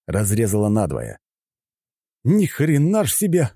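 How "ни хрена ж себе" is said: high-pitched startled exclamation; exclaiming. A narrator's reading. Fighter seeing the damage, exclaiming in astonishment. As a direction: exclaim it with a sharp lift in pitch. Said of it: "воскликнул я".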